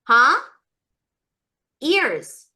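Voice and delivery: normal talking voice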